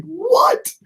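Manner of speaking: high-pitched incredulous yelp. Acted. Streamer reacting to a silly documentary line, incredulous. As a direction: blurt it way up high in disbelief.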